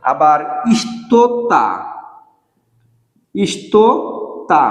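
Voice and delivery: ইষ্টতা stretched very slowly; ইষ্টতা spoken slowly to dictate; slow model pronunciation. Shown as a demonstration. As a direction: draw out ইষ্টতা syllable by syllable. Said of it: "এইটা মানে কিন্তু কিছু".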